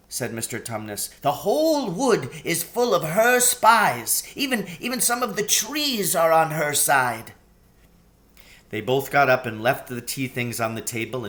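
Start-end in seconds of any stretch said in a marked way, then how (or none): 1.2-7.3 high, anxious faun voice; a fretful, sorrowful faun. A narrator's reading. Tumnus warning.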